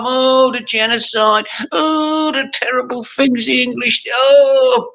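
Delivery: high, hammed-up wailing complaint; mock lament. Sick of hearing it every time, mimicking the complainers in high mock wailing. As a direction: moan it up high, mocking the complainers.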